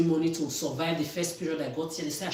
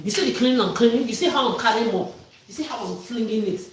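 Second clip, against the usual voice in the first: loud, higher and bitter; indignant shout; 4 semitones higher, 7 dB louder than usual. Real, not acted. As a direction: yell it, demanding they look. Shouting about her cleaning work.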